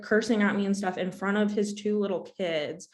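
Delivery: ordinary talk, nothing marked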